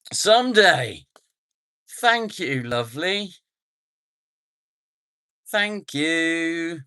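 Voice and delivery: high loud grateful thanks; high excited thank-you to a donor. Real, not acted. Thanking a super chat donor.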